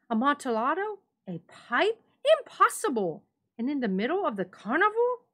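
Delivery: put-on high, loud, excited drunk reveller; astonished Fortunato. A narrator's reading. Drunk friend hearing of the rare wine, loud astonishment. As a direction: blurt it out in disbelief, fast at the end.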